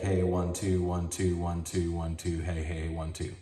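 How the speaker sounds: usual speaking voice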